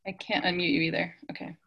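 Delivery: ordinary talk, nothing marked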